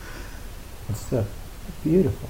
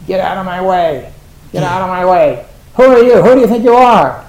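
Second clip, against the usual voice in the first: much higher, much louder squawking yell; angry bird shout; 6 semitones higher, 12 dB louder than usual. Pretend. Teacher voicing birds quarrelling outside, angry squawking shout. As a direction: shout like a territorial bird guarding its ground.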